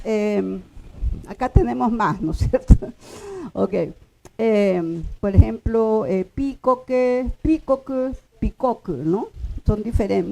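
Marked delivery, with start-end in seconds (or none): none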